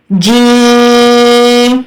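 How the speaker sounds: "جیم" stretched very slowly over several beats; elongated letter. Shown as a demonstration. Teacher showing students how far to stretch "جیم". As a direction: draw out "جیم" long and steady. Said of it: "تھوڑا سا زیادہ کھینچ کر پڑھیں گے".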